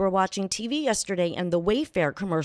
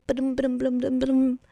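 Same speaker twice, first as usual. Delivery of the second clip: vocal drum roll; 4 semitones higher than usual. Pretend. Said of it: "drum roll".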